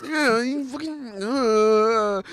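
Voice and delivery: high-pitched flustered protest; embarrassed sputtering that dissolves into noises. Acted. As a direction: stammer a denial, dragging out the words. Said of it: "he's all flustered and scared".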